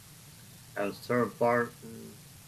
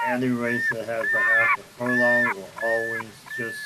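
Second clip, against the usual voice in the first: ordinary delivery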